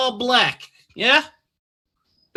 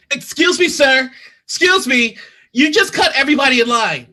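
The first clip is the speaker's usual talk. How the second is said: loud, higher, sharp call across the queue; 6 semitones higher, 8 dB louder than usual. Acted. Annoyed customer calls out the line-cutter, loud.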